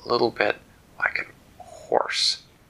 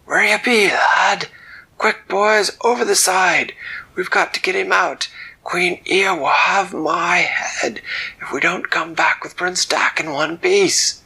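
much higher, loud panicked orders; frantic shouting captain; 10 semitones higher, 7 dB louder than usual. Acted. Captain yelling orders to his crew, frantic. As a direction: bellow for the crew to jump in. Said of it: "shouting orders".

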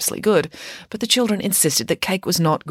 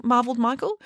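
plain reading voice

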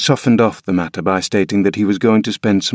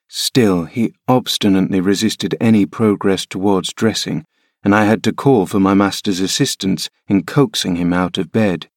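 ordinary delivery

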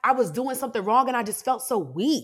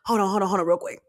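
imitating a choked-back cry; holding back tears; faster than usual. Telling the therapist to wait, mock holding in tears. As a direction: act out fighting back tears. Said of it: "Trying so hard to hold back them tears".

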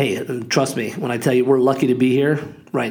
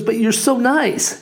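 higher-pitched imitation of an upset woman; retold upset lady; 9 semitones higher than usual. Lady who dented the car to its owner, imitated upset woman. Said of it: "you know, all upset, crying a little bit".

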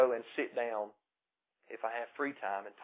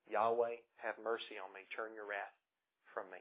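softer, hushed prayer for mercy; humble quiet plea; 3 semitones lower, 9 dB quieter than usual. Pretend. Preacher voicing the tax collector's humble prayer, softly hushed. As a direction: murmur it as a humbled sinner.

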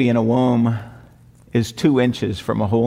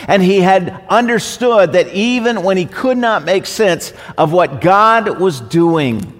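higher, louder, building sermon cadence; fervent pulpit preaching, voice lifted high; preaching at full force; 7 semitones higher, 5 dB louder than usual. Acted. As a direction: preach it out, rising with fervour. Pastor climbs into full preaching.

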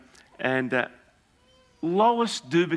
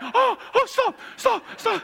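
much higher, panicky "stop, stop"; alarmed plea; slower than usual. Retold or imitated. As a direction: urge her to stop in comic alarm.

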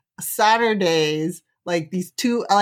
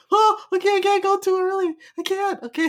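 high, wide-eyed pushover voice; high, meek mock of yourself giving in; mock naive self; 11 semitones higher than usual. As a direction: play your younger self dutifully swallowing bad advice. Mock naive reply to warning.

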